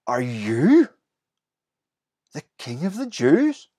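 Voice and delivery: incredulous, the pitch climbing at the end; stunned, can't believe what he's seeing; astonished. Retold or imitated. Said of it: "you can almost hear the astonishment".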